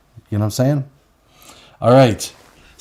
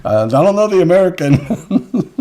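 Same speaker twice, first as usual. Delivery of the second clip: mock Nihad, laughing; 6 semitones higher, 4 dB louder than usual. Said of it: "It was, you know".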